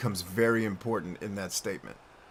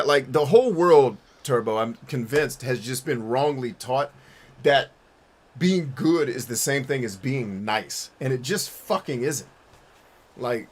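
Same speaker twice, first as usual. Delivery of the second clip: ordinary delivery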